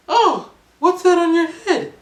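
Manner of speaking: high, bright put-on lady voice; Japanese lady. Japanese lady asking the London guy where he is from, high voice. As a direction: pitch way up, friendly and curious. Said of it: "the conversation went like this".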